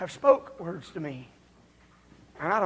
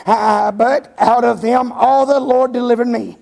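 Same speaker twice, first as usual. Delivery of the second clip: louder, ringing scripture proclaimed; preacher thundering Paul's words; preached scripture reading; 2 semitones higher, 11 dB louder than usual. Acted. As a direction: declaim the verse to the rafters. Reads scripture in full preaching.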